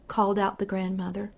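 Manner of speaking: usual speaking voice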